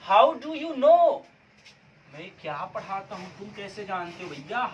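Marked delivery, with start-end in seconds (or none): 0.1-1.2 high, loud and very slow; loud, drawn-out model answer. Shown as a demonstration. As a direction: call the answer out to the whole class. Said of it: "अब ये आपका ये हो गया ना". (ज़ोर से खींचकर उत्तर बोलना)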